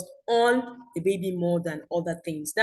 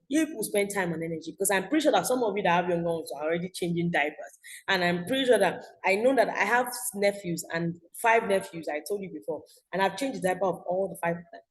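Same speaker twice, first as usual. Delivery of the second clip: ordinary talk, nothing marked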